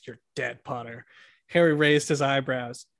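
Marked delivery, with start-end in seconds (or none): 0.0-1.1 much softer, low threat; quiet menacing threat. A narrator's reading. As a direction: say it under your breath. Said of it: "said in a low voice".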